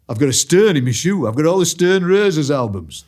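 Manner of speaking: Hull accent. Shown as a demonstration. Fan of accents showing how great Hull sounds, Hull accent. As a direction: show off a Hull accent with relish. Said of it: "Hull is such a great accent".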